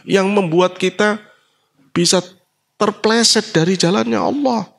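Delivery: high, insistent sermon delivery; emphatic preaching. Real, not acted. Preacher warning listeners about worldly sorcery, emphatic preaching. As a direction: build the list, climbing very high.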